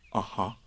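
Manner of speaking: soft, knowing "Aha"; meaningful Aha. A narrator's reading. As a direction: say "Aha" as if hinting at a secret. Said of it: "in that sort of voice".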